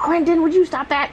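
high-pitched scolding of a pet. Real, not acted.

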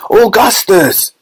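high, drawn-out alarmed cry; long high-pitched shout; Mister Wonka. A narrator's reading. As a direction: cry out slowly to stop him. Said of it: "cried Mister Wonka".

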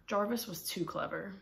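ordinary delivery, nothing marked

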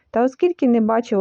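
usual speaking voice

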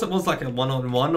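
ordinary talk

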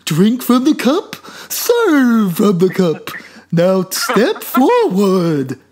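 high, loud, slow cartoon teacher voice; Hanna-Barbera character. Mocking the teacher's dub as a Hanna-Barbera character, slow and high. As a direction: drag it out like a cartoon sage. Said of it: "like a Hanna-Barbera character".

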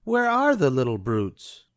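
scornful diva demanding; haughty high Cruella sneer; Cruella de Vil. A narrator's reading.

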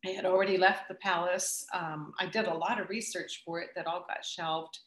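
ordinary talk

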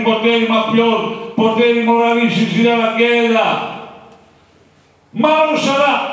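rally orator. Retold or imitated. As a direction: lay out the enemies to the crowd. Capanna addresses the assembly. (oratore da assemblea, lento e forte)

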